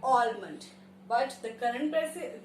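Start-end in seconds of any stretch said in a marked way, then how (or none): 0.0-0.6 "almond" as AWL-mund, lower; as spelled. Shown as a demonstration. Teacher reading from the spelling, mispronounced with L. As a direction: sound the L in "almond". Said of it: "पढ़ने में तो कैसे आ रहा है?".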